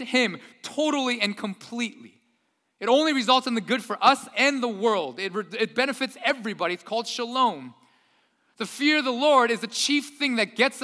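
loud, high-pitched preaching at full force; fervent pulpit delivery. Acted. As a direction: preach it out to the congregation. Preaching with building fervour.

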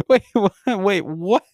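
retold stunned reaction, laughter creeping in; shocked disbelief. Acts out his shocked reaction to friend.

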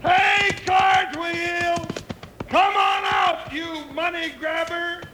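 long, drawn-out hog-calling yell; big holler. Acted. Jingles summoning hidden Cartwheel, hollering slowly. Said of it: "You better give him a yell".

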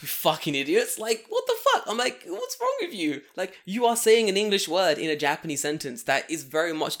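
acted outrage, voice jumping up on the questions; indignant telling-off. Man retelling how he confronted two gossipers, indignant.

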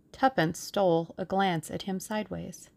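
ordinary delivery, nothing marked

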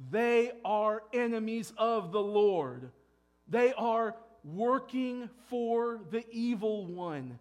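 slow, loud, emphatic preaching; forceful preaching. Real, not acted. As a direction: hammer home the warning, slowly. Preacher thunders a warning.

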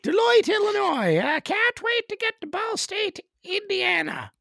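very high, loud Newfoundland-style caricature; put-on accent voice. Touring musician talks to self about next stops, high Newfoundland accent. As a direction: call out place names in a high Newfoundland voice. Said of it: "I like to do accents in this voice".

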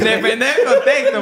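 says it through a laugh; laughing while talking. Real, not acted. (riéndose)

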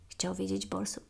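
ordinary delivery, nothing marked

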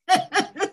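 laughing. Real, not acted. Laughing at a fun introduction.

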